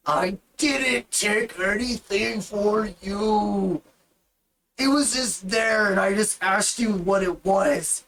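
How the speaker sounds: lightly voiced minotaur, slow protest. Minotaur telling the party he only asked about the bag. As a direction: plead your innocence, a bit put out.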